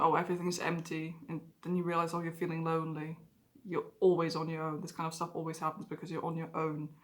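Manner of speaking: low, soft, hollow murmur; drained, barely bothering to speak; empty and quiet. Retold or imitated. Alone at the desk, soft and low with empty apathy. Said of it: "you're realizing".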